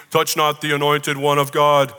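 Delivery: slow, weighty mock proclamation; mock-solemn scripture quote. Preacher quoting misused scripture at his church, mock-solemn. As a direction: intone it like a self-important church leader.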